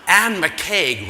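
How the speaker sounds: name thrown out loud to the crowd; emphatic aside. Real, not acted. Telling the audience who called, loud and emphatic. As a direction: punch the name loudly for effect.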